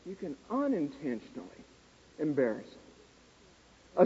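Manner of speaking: hushed emphasis. Acted. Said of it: "emphatic point".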